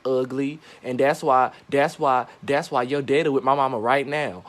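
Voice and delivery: ordinary delivery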